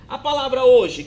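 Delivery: normal talking voice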